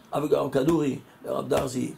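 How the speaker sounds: ordinary delivery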